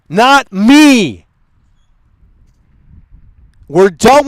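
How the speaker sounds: loud, slow, scolding the chat; angry lecture. Real, not acted. Streamer angrily tells chat it's going members only. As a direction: lay down the law, fed up and loud.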